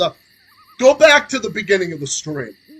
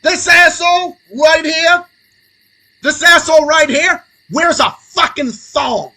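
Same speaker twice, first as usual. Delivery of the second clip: angry shout; 5 semitones higher, 5 dB louder than usual. Real, not acted. Ranting shout at the senator.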